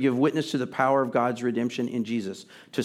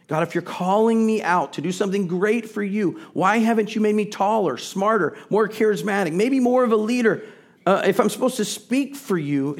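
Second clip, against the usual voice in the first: rising, louder pleading with God, pitch climbing to the end; questions to God growing higher and louder; pleading complaint; 7 semitones higher, 4 dB louder than usual. Retold or imitated. Voiced complaint to God, building.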